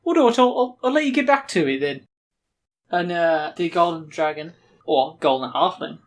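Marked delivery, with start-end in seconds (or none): 0.0-2.1 high Brummie character voice; Brummie accent. Acted. Dave excuses himself to the players, high Brummie accent. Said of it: "This is the weirdest accent".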